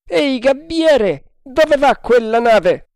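high, slow, loud call; shouted hail. A narrator's reading. Quartermaster asking lookout the ship's course, shouting. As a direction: holler the question up the mast.

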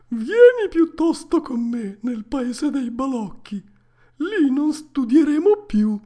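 put-on very loud, high boyish voice, building; Lucignolo. A narrator's reading. Pinocchio quoting his tempting schoolmate, loud and high. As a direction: egg him on, swelling to a big loud finish. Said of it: "Ma Lucignolo mi disse".

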